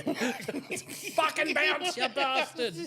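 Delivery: high, very drawn-out mock yell; hammed-up order through laughter; mock angry urging. Yelling at someone on a trampoline, mock angry.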